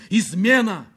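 high, urgent alarm; shouted warning from below. A narrator's reading. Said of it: "раздался голос снизу".

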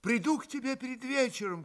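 high call over the shoulder. A narrator's reading. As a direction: call it back while running. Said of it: "крикнул Алёша". (крикнул вдогонку)

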